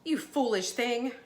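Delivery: acted distressed reproach; dismayed exclamation. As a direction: cry out in dismay at a pet's folly. Woman finds her cat dead.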